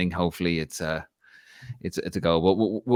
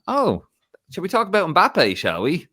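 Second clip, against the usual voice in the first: much higher, mocking sarcastic jab; sarcastic retort; 10 semitones higher, 4 dB louder than usual. Putting down the PSG fan at work, sarcastic retort. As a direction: needle him about Mbappe.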